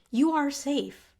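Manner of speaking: slow reassurance. Real, not acted. Reassuring anxious listeners.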